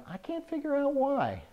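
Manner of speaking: put-on squeaky voice, very high; high-pitched squeal. Host feigns puzzlement at feeling flushed, high squeaky voice. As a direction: voice shoots up comically and holds.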